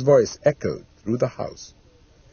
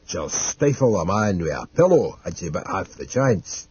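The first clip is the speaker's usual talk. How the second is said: Mister Dodd, wary servant; 5 semitones lower than usual. Acted. Mister Dodd warning against the woman, low and suspicious. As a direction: lower character voice, grimly suspicious.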